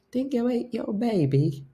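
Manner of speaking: put-on high-pitched go at an Australian accent; attempted Australian accent. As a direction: fumble through an Australian accent. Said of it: "I don't even know how to sound Australian".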